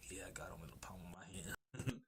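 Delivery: low, soft near-whisper; quiet smugness. Retold or imitated. Loki boasting to Luffy about Shanks, quietly smug. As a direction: play Loki murmuring a boast.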